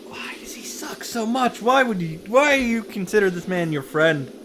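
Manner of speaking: ordinary talk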